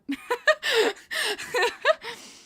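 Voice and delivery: loud giggle; high giggling laugh. Real, not acted. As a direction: burst into giggles. Laughing after Burakovsky story.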